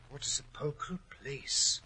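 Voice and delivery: hushed whisper in the tomb; whispering in awe in the crypt; awed whisper. Acted. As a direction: breathe it under the breath. Entering the dark crypt.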